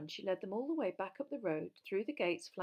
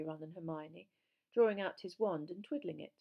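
usual speaking voice

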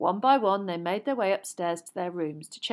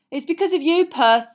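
loud, high, earnest explanation; put-on seriousness; mock serious; 9 semitones higher, 12 dB louder than usual. A narrator's reading. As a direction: tell him with a straight-faced air. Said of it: "said George seriously".

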